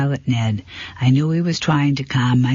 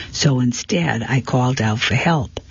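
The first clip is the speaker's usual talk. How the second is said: ordinary delivery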